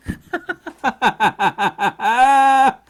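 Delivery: long, loud, high cackling laugh. Real, not acted. Cracks up at own joke.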